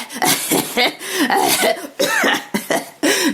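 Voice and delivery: high mock chuckle; imitated old man laugh. Old man laughing at her joke.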